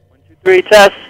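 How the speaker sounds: loud counting into the phone; loud mic check. Real, not acted. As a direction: test the line loudly.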